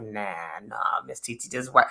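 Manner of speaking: low, soft, dismissive husband voice; lightly voiced laid-back husband. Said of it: "Swift was like".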